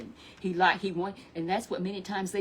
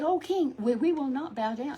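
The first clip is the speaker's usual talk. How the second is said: high earnest refusal; 7 semitones higher than usual. Acted. Boys answer the king.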